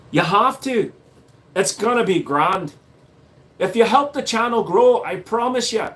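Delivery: cheeky put-on Irish accent. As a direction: coax them as a mock Irishman. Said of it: "My Irish and my Scottish go too close".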